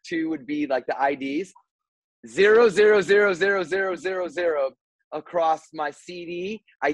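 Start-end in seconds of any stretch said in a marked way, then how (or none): 2.3-4.7 high and loud at first, fading down; the dread sinks in with each zero; horrified realization. Retold or imitated. Reading the empty counter in shock.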